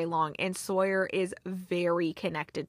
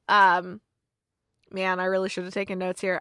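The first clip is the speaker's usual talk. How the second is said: ordinary talk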